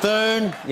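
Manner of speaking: "Fern" for phone, vowel turned to er; mocking mispronunciation. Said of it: "trouble with the word phone".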